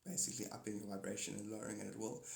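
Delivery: ordinary delivery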